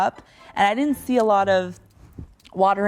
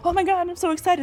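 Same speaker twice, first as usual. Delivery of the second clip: excited; 9 semitones higher than usual. Retold or imitated. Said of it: "and then he'll be like".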